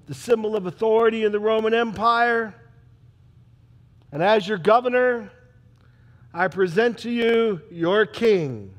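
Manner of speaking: formal proclamation. Acted. Pilate presenting Jesus to the crowd, loud proclamation. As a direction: proclaim it from the judgment seat.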